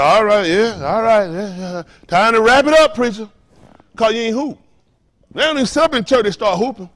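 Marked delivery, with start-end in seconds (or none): 0.0-3.3 congregation hurrying preacher. Retold or imitated. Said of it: "They said".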